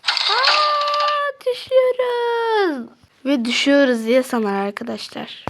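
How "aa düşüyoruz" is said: much higher, slow drawn-out wail; panicked falling cry. Acted. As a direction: shriek as the lift drops. Asansör düşüyor paniği. (düşerken panik çığlığı)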